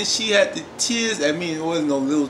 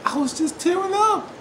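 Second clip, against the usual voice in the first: very high-pitched mock tearful voice; high, overcome whimper; tearing up; 13 semitones higher than usual. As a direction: squeak it out as if choking up. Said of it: "I really was tearing up".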